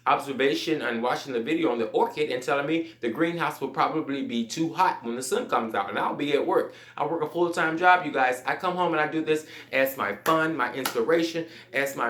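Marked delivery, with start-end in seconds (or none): none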